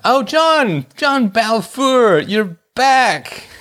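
high, drawn-out gleeful greeting; mock delight. Host greeting his returning professor stalker, mock delight. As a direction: hail a returning critic with hammed-up joy.

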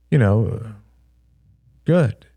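hesitant, halting answer; unconvincing, stop-start reply; trailing off. A narrator's reading. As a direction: let the answer peter out after a pause. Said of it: "Shane's voice trailed off".

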